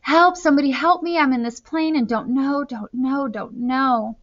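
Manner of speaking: high, desperate cry for help, held in by narration; panicked scream. A narrator's reading. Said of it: "he began to scream into the microphone".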